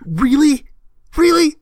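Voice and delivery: high, slow, cracking disbelief; incredulous disbelief. Retold or imitated. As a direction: replay the shock of finding out, voice cracking up high. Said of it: "I was just like".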